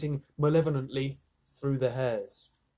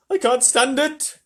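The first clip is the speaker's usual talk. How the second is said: wailing; 10 semitones higher, 8 dB louder, slower than usual. A narrator's reading. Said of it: "wailed the earthworm".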